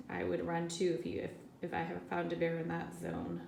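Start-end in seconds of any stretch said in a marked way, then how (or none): none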